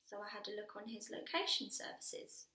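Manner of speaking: ordinary delivery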